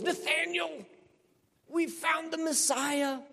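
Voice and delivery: high, eager and slow announcing; excited Philip. Retold or imitated. Philip tells Nathanael about the Messiah: high, excited call. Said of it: "When Philip goes".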